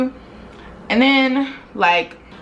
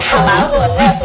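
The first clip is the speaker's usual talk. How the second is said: the woman on the phone; 5 semitones higher, 7 dB louder than usual. Retold or imitated. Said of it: "And she's like".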